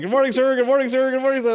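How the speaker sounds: high, loud, eager recruit salute; eager recruits greeting. Retold or imitated. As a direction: bark it out like a keen cadet. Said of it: "a hundred hands are coming up".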